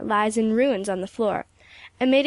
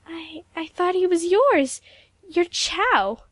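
flustered stammer; 8 semitones higher, 4 dB louder, slower than usual. A narrator's reading. Stammering confusion.

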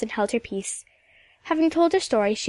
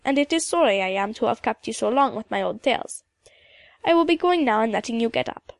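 put-on old Scottish storyteller with a lilting accent; old Highland woman. Mistress McIntyre humbly takes leave.

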